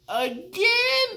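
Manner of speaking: high, loud incredulous yelp; are you kidding me. Retold or imitated. Fan seeing the rapper choke twice, loud high disbelief.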